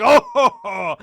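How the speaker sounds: high, loud mocking "oh ho ho"; villain chuckle. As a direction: laugh off the bullets smugly. Villain laughing off bullets.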